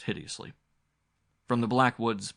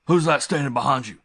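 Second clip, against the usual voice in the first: loud, higher angry demand; furious shout; 6 semitones higher, 11 dB louder than usual. A narrator's reading. Suspicious uncle shouts at figures behind Ashley, furious. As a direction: bark it at the strangers. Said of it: "he shouted furiously".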